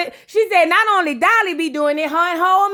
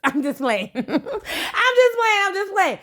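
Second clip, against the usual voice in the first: laughing it off; 4 semitones higher, 4 dB quieter, slower than usual. Real, not acted. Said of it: "laughing after a joke".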